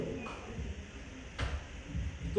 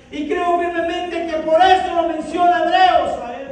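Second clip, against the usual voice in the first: rising, louder preaching about faith; fervent preaching; 4 semitones higher, 4 dB louder than usual. Acted. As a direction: preach it hard to the congregation. Preaching builds to full force. (predicación fervorosa y fuerte)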